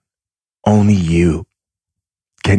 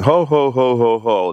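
higher, jolly mock ho ho ho; belly-laugh like a jolly old man, pitched up; Santa Claus laugh; 5 semitones higher than usual. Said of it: "it's literally Santa Claus laughing".

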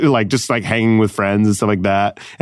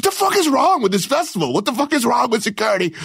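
high-pitched exasperated rant; retold meltdown, voice pushed way up; snapping; 12 semitones higher than usual. Performer railing at festival security, snapping. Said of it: "then I'm, like, snapping".

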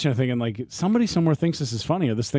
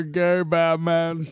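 mimicking a sound; 5 semitones higher, much slower than usual. Shown as a demonstration. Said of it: "trying to replicate the sound I'm hearing".

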